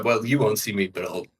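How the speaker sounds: usual speaking voice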